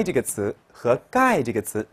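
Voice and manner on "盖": "盖" with no breath after g, higher; unaspirated. Shown as a demonstration. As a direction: say "盖" with a tight unaspirated g. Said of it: "属于不送气音".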